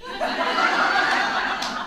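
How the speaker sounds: high breathy burst of laughter. Real, not acted. Minister laughing at her therapist's reply. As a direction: crack up at the retort.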